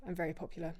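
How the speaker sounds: soft, dry mock boast; sarcastic. Real, not acted. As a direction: brag quietly, not meaning it. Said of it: "That was sarcasm".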